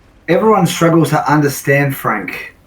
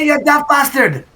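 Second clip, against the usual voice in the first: much higher, hammed-up Scottish brogue; over-the-top Scottish shout, pitched way up; ridiculous Scottish accent; 12 semitones higher than usual. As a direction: ham up a silly Scottish sailor yelling a warning. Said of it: "has a particularly ridiculous Scottish accent".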